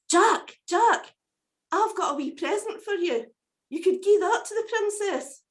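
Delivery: high, chirpy little bird voice, grateful; a small rescued bird thanking its saviour; wee cuckoo voice. Acted. Cuckoo thanks Jack for saving its life, high grateful bird voice.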